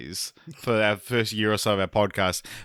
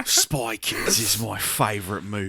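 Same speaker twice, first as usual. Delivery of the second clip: put-on, less posh British accent. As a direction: do a common British voice. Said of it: "Can you guys do a British accent?".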